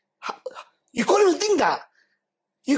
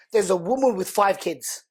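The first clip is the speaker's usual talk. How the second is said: ordinary talk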